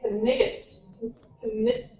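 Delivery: Knigget with the K and G sounded; reading Knight letter by letter as Knigget; mispronounced name. Retold or imitated. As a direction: say Knigget as it is spelt, K and G heard. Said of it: "And they're like".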